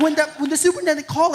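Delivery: ordinary talk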